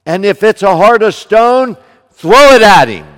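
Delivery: loud, high, climbing preacher's cry; full-force preaching. Acted. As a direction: build to a roaring sermon climax. Preaching climax, rising intensity.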